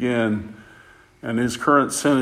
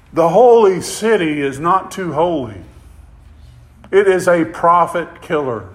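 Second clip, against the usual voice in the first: higher and louder, preaching at full force; fiery preaching; 5 semitones higher, 7 dB louder than usual. Acted. Preacher rousing his congregation about an arrested pastor, fiery full-voiced preaching. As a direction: drive it home to the congregation.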